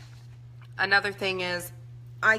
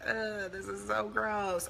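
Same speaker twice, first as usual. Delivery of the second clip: softer, slow disgusted groan; mock self-disgust; 2 semitones higher, 5 dB quieter, much slower than usual. Wife imitates herself complaining about her body, slow and soft. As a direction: grumble at yourself in disgust. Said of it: "saying things like".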